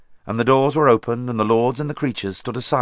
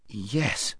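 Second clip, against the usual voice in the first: doubtful; 8 dB quieter than usual. Acted. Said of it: "said King Edmund doubtfully".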